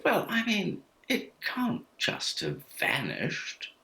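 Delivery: high start, then very slow and prim; posh little boy. Retold or imitated. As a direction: imitate a prim posh four-year-old. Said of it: "weirdly become very, very posh".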